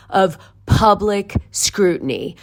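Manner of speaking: slow, separated words for emphasis; deliberately slow. Real, not acted. Stressing to listeners the purpose of the documents, said slowly. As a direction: spell it out slowly, word by word. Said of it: "and I'll say this slowly".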